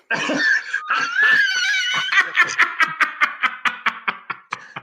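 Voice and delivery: very high, shouting laughter; real roaring laugh; howling laugh. Real, not acted. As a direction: cackle hard at your own punchline. Host laughs at his own joke.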